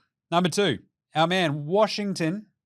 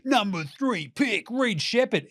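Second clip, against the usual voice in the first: announcer voice; 5 semitones higher, slower than usual. Retold or imitated. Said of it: "it's like".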